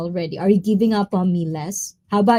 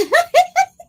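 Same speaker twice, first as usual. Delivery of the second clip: high squeal laugh; 6 dB louder than usual. Real, not acted. Laugh at chat.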